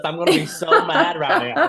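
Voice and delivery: high, loud laugh through "so mad"; laughing mock anger. To Andy about the celebrity, high laughing mock anger. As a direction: threaten in jest, laughing. Excited fan retelling.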